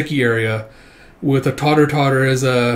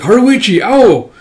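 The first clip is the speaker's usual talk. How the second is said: high, loud calling out; calling out names; 9 semitones higher, 8 dB louder, slower than usual. Acted. Kikoru calling to her returning comrades, loud call. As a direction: shout their names across the field.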